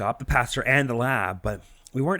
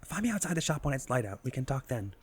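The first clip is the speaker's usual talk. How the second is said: hushed whisper; 5 semitones higher, 10 dB quieter than usual. Acted. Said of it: "Why are they whispering?".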